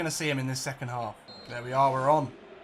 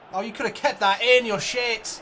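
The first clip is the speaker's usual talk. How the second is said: higher, louder exasperated groan at the miss; frustrated outburst; 7 semitones higher, 7 dB louder than usual. Real, not acted. Gamer yelling at a wasted chance, frustrated. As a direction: let the annoyance burst out.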